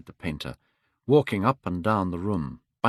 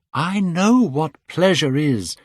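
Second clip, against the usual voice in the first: put-on young Dorian crying out; 8 semitones higher, 4 dB louder, slower than usual. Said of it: "cried Dorian Gray".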